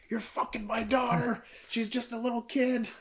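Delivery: high, strained mock fury at Cal; enraged dad. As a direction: play the furious dad tackling him.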